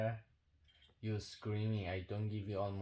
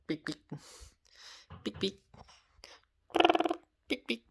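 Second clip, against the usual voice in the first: squeaky, much higher beep noises; rising higher with each beep; playful beep beep; 11 semitones higher, 4 dB louder than usual. Acted. Owner teases the dogs with squeaky, ever higher beeps.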